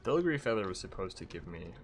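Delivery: ordinary talk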